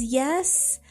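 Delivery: ordinary delivery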